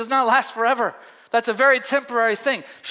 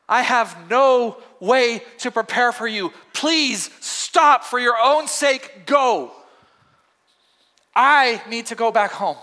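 slow, heavy plea, pitched a little higher; weary plea; 3 semitones higher, 4 dB louder, slower than usual. Acted. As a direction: drag out each word like a worn-out mother. Naomi's final plea, slowed.

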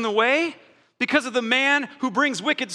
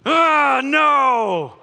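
mock angry refusal; 3 semitones higher, 6 dB louder than usual. Acting out fretting spouse refusing.

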